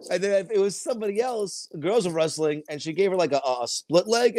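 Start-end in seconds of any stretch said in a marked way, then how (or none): none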